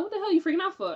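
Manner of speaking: high-pitched, quick challenge; retold exasperated demand. Housemate questioning a panicking maid, mock exasperated. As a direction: imitate someone baffled by another's panic. Retold exchange during a freak-out.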